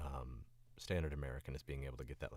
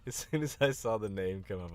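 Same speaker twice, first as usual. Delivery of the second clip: loud and high, coming out of a laugh; 5 semitones higher, 9 dB louder than usual. Real, not acted. Explaining the joke about Stacy, still laughing. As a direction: push the words out through laughter.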